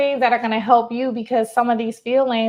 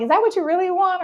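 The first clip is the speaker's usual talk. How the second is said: higher probing question; 9 semitones higher, faster than usual. Retold or imitated. Coach questioning client.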